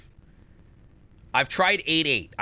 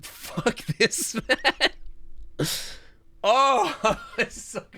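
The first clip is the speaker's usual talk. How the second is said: high, squeezed-out words through laughter; exasperated laughing; 8 semitones higher, much slower than usual. Real, not acted. Streamer cursing the puzzle, exasperated laughing. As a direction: swear while cracking up in frustration.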